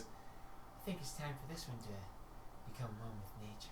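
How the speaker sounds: soft, fast, creepy murmur; creepy quiet. Acted. Tia threatening the captive, quiet and creepy. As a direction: let the threat slip out softly. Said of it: "she goes".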